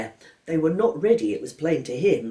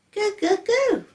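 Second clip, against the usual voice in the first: put-on very high baby babble; baby George mocking the test; 4 dB louder, slower than usual. A narrator's reading. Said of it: "said George".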